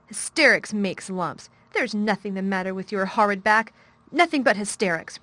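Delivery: loud, fierce scolding; girl fiercely contradicting the boy; fierce contradiction. A narrator's reading. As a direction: flatly contradict him. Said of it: "contradicted Mary fiercely".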